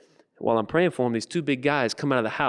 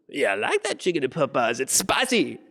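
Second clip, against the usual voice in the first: put-on voice rising higher at the end; relishing character voice; 4 semitones higher than usual. Man agrees to Popeyes chicken, relishing character voice. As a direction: savor it in the man's put-on voice.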